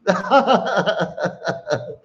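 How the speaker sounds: high, rippling run of laughter; long laugh. Real, not acted. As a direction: laugh at your own misfortune. Laughs at losing viewers.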